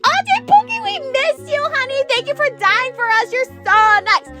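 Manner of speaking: high cooing sweet voice. Acted. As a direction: coo lovingly at him. Everyone cooing at risen Jesus.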